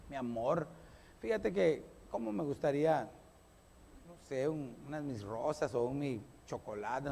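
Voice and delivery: soft, low, coaxing ask; wife sweetly asking husband for roses; sweet pleading. Pretend. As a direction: coax him gently for a gift.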